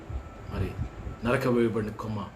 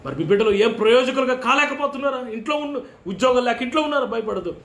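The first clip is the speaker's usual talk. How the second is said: high, loud fervent preacher's cry; preaching at full force; 13 semitones higher, 7 dB louder than usual. Acted. Pastor crying out to the congregation, high and loud. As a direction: call out to the congregation with fire.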